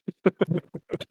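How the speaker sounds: hyena laugh. Real, not acted. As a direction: burst out in a wild, squealing laugh. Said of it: "your mic just fucking peaked whenever you laugh".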